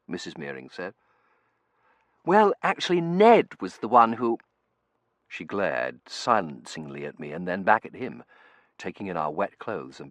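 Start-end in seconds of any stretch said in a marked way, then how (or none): 2.2-4.4 put-on young man voice. Terence character voice.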